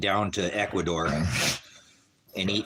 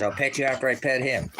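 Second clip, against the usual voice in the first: normal talking voice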